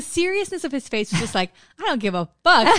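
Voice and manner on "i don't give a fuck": flat dismissive quote, a bit louder; deadpan retort. Retold or imitated. Retelling a bored man's answer to the news, dismissive. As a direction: toss it off like you couldn't care less.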